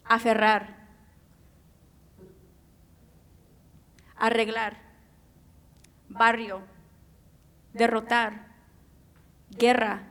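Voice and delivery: each word said alone with a full trilled rr, as in "aferrar" and "guerra"; rolled Spanish R's. Shown as a demonstration. Speaker showing the audience the sound of Spanish R's, trilled with relish. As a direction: savor the rolling rr in every word, "barrio", "tierra". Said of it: "there is nothing like the sound of las R's".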